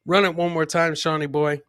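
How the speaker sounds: normal talking voice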